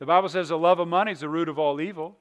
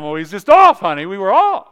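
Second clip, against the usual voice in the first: mock pleading to wife; 10 dB louder than usual. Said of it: "talking to wife about due date".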